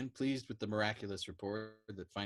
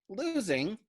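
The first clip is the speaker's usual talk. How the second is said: incredulous cry; 11 semitones higher, 5 dB louder than usual. A narrator's reading. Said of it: "Doc Danica cried".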